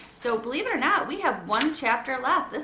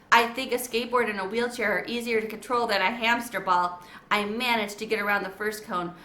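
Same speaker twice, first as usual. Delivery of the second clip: plain reading voice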